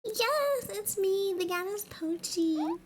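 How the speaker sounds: high, grand goddess voice. Acted. Goddess Pochi greeting her fan, high and grand. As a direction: announce yourself as a goddess.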